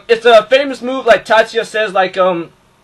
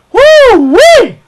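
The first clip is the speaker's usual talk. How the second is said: excited whoop; 14 semitones higher, 9 dB louder than usual. Real, not acted. Thrilled at skill shown.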